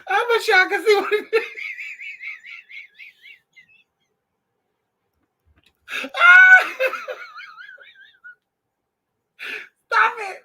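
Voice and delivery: high, loud laughter breaking the words; cracking up so hard it squeaks; helpless real laughing fit; laughing hysterically. Real, not acted. Laughing fit at dog's look.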